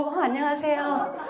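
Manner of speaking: slow, drawn-out polite hello; sudden polite greeting. Retold or imitated. Sponsor turning to greet someone, slow and courteous. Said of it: "갑자기 누구".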